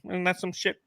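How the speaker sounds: high, soft, offhand general's verdict; imitated general. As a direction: toss it off as the unbothered general. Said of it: "the general comes through".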